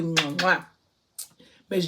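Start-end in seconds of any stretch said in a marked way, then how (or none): 0.0-0.7 two quick smacking air kisses; affectionate kiss sounds for a friend; blown kisses. Real, not acted. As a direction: blow two kisses to the camera. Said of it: "So kisses to you".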